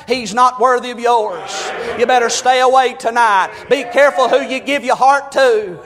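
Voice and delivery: ordinary delivery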